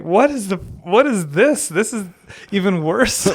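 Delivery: high, amused disbelief; incredulous. Retold or imitated. Recalling the library stalls, amused disbelief. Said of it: "And I was thinking".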